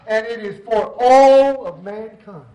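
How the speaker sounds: "all" drawn out long and loud; slow down and swell on "all"; stress on "all". Real, not acted. Preacher telling church grace is for everyone, stress on "all".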